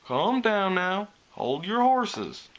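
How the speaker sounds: lightly voiced big stallion, slow and easy; a hint of a calm farm stallion, unhurried; slow country drawl. A narrator's reading. McIntosh voiced in read story.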